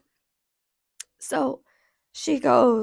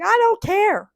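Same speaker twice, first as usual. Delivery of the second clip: high, louder mocking defiance; defiant retort; 9 semitones higher, 7 dB louder, slower than usual. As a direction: toss it back, high and loud. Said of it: "And I'm like".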